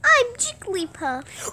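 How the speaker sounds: high, slow, cutesy squeak; sweet little creature voice; Jigglypuff. Pretend. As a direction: draw it out in a tiny high voice. Joke character impression.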